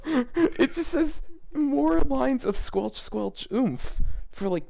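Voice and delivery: high, slow, cracking with laughter; can barely get the words out for laughing; laughing in disbelief. Real, not acted. Reading the fanfic aloud, cracking up in high-pitched disbelief.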